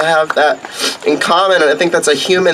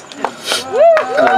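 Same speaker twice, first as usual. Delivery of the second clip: after crying. Real, not acted. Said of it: "I've been crying all morning".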